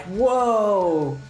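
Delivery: ordinary talk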